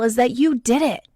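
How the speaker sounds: normal talking voice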